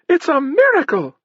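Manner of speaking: put-on very high falsetto girl, theatrically amazed; girl's astonished cry. A narrator's reading. Stage girl marvelling at fire, astonished falsetto cry. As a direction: pipe it up high in mock surprise. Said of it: "cried the girl".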